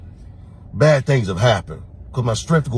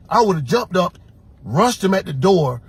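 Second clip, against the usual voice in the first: normal talking voice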